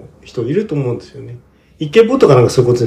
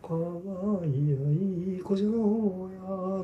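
put-on slow, much softer old man coaxing; creaky wise old man; 6 semitones higher, 15 dB quieter, much slower than usual. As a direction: hush it slowly like an old spirit. Quoting the Erlking from song.

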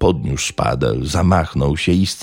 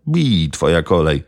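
curt, hard command; lightly voiced sharp command; sharp order. A narrator's reading. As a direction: hand over the spade with a sharp order. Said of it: "rzekł ostro".

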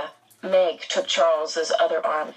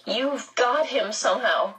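higher-pitched accusation at normal volume; understated shout; 5 semitones higher than usual. A narrator's reading. Meg accuses the red-eyed man, mildly shouting. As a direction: accuse him with a mild, raised-pitch cry. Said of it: "Meg shouted".

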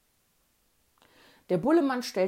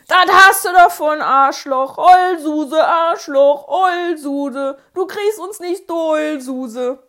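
gleeful yelled taunts, much louder and higher; jubilant taunting; 6 semitones higher, 14 dB louder, slower than usual. Retold or imitated. Kids cheer and taunt the crying bully, yelling. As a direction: chant the insults in triumph. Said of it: "Während wir jubelten".

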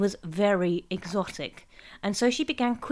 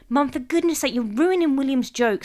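higher, louder telling-off; exasperated scolding; 6 semitones higher, 6 dB louder than usual. A narrator's reading. Sarah protecting William's joke from Mum, exasperated. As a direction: snap at her to stop.

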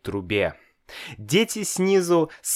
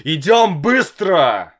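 loud urging; 6 semitones higher, 7 dB louder than usual. A narrator's reading. Father urges loudly.

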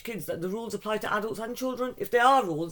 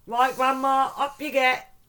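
put-on bossy child; 7 semitones higher, 6 dB louder, slower than usual. Granddaughter ordering grandma.

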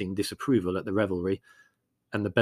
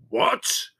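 a gruff roar; 5 dB louder than usual. A narrator's reading. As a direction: bellow it out. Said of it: "he roared at Andrucelli".

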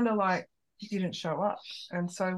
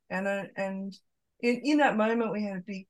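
ordinary talk

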